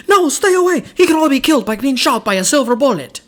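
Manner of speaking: high, loud imitation of a Transylvanian-style accent; urgent warning in her Eastern European film accent; imitating her accent. Quoting Kate Beckinsale's warning, in a loud high mock accent. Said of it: "she has, like, that accent that's so fun".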